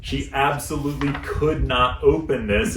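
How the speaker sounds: usual speaking voice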